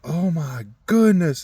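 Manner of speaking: high, slow gasp of alarm; an older lady startled by blood; shocked gasp. Retold or imitated. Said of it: "she just goes".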